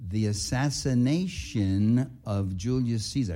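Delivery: slow, drawn-out "The Assassination of Julius Caesar."; dwelling on the title. Retold or imitated. Writer telling the customs officer his book title, slow and weighty. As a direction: linger on "Assassination" for effect. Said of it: "I really dwelled on it".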